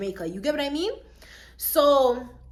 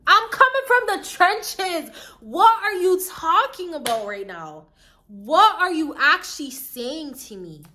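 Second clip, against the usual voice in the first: louder, higher, slower disbelief; incredulous outrage; 6 semitones higher, 4 dB louder than usual. Retold or imitated. Telling off the girl who lied about her, in loud, high, slow disbelief. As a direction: demand to know what she's talking about.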